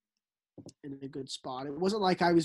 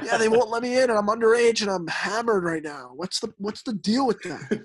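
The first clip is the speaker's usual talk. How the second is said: put-on whiny drunk complaint; 6 semitones higher, 7 dB louder than usual. Drunk teen complaining to a cop.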